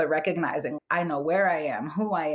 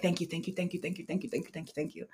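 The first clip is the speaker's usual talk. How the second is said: tearful thanks; 9 dB quieter, faster than usual. Retold or imitated. Sobbing on knees in gratitude.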